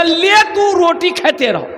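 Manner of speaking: high, laughing mock yell; hammed-up outburst at the wife; comic husband snapping at wife; mock angry husband. Husband throws things in anger.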